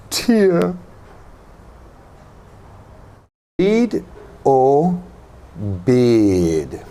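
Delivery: "tea" held long, "tear" gliding toward "uh"; teacher demos ee versus ear; long vowel against diphthong. Shown as a demonstration. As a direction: model each pair slowly and clearly. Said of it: "listen and repeat after me".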